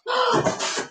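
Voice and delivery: high, soft gasp; astonished gasp. Real, not acted. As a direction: suck in a breath in amazement. Amazed by another pan.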